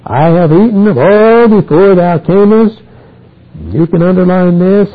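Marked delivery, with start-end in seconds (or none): 0.1-2.8 agitated high, loud outcry; trembling alarm. Acted. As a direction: act out Isaac's shock, voice pitched way up. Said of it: "Isaac trembled very exceedingly".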